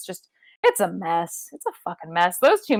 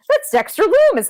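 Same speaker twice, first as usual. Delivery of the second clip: much higher, loud sure claim; mock certainty; 6 dB louder than usual. Podcaster imitating own brain, high and loud. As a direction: blurt it like a sure thing. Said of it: "my brain was just like".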